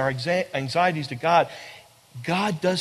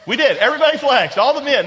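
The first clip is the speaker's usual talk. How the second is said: playful, rising excitement; 5 semitones higher, 6 dB louder than usual. Real, not acted. Teasing the men who flexed.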